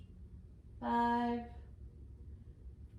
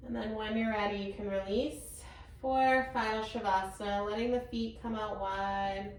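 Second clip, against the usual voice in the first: guided relaxation; 3 dB quieter than usual. Acted. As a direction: lead them gently into rest.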